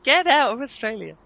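high-pitched cry of frustration; high, comic despair; exasperated self-scolding. Real, not acted. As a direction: order your accent out of Australia. Scolds own drifting accent.

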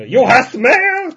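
slow, loud mock German accent. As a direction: announce it in a hammy German accent. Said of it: "have a German accent when it arrives".

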